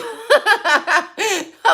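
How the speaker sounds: high, bright burst of laughter; real laugh breaking out high. Real, not acted. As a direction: laugh out loud at the picture. Laughs at the Putin image.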